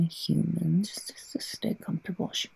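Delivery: fast, soft whisper. Acted. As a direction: hush them close to sleep.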